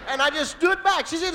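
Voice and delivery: ordinary delivery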